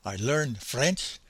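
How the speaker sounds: quiet, lightly imitated German accent; a gentle German accent in broken English. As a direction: keep the soft German accent through the memory. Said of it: "my attempt at his accent".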